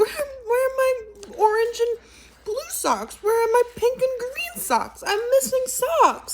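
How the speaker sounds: very high, whining, almost crying; whiny high sock-lover. Acted. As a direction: wail over the lost socks like a needy creature. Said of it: "And I'll be like".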